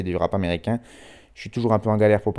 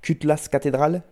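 higher-pitched, fully French pronunciation; French accent; 6 semitones higher than usual. Shown as a demonstration. Radio host showing it sounds ridiculous too, in a French accent. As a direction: say the English title as French words. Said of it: "aussi ridicule avec l'accent français".

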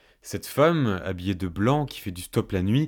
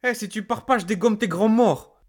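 high, blustering yell; fake-brave shouting; 12 semitones higher, 4 dB louder than usual. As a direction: shout threats to hide the fear. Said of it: "je criais des trucs du genre".

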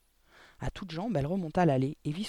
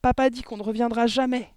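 put-on loud, high, desperate child; distressed cry; 8 semitones higher, 8 dB louder than usual. A narrator's reading. Girl telling her teacher they won't return, distressed cry. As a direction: wail the news at full voice. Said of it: "s'écria Mathilda".

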